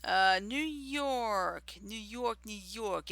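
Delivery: put-on slow, drawn-out New York accent. Host reading the chart to stream, in a New York accent. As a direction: lean into a Brooklyn New York accent, stretching the words. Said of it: "I'm talking like a New Yorker".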